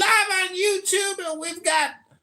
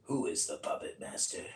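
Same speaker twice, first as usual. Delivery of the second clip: much lower, whispered creepy voice; 13 dB quieter than usual. Acted. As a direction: ask it like a sinister puppeteer. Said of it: "that was a creepy voice".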